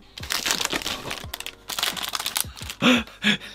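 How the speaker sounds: long rire soufflé qui monte dans l'aigu; fou rire étouffé. Real, not acted. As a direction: rire qui finit par éclater. Découvre des produits bretons. (fou rire étouffé)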